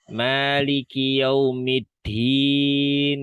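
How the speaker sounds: "Maliki yaumiddin" drawn out slowly, each syllable held; teacher recites a verse to show the dialect; slow Quranic recitation. Shown as a demonstration. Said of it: "misal gitu ya".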